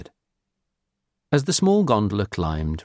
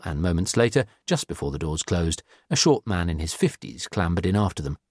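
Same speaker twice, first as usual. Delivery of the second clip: usual speaking voice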